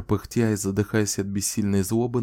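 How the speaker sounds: ordinary delivery, nothing marked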